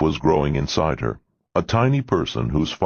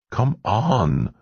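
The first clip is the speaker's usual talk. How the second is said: pleading; 6 semitones higher than usual. A narrator's reading. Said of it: "in a pleading tone".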